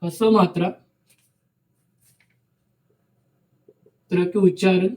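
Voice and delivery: slow dictation, each word spaced for note-taking. Shown as a demonstration. Teacher to students: slow dictation.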